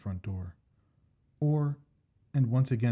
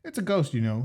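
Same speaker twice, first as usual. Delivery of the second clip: higher, eager Jack; Jack's voice; 4 semitones higher, 5 dB louder than usual. Acted. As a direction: voice the keen friend Jack, pitched up. Jack's voice in story.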